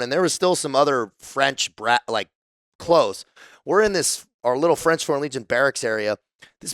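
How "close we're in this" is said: usual speaking voice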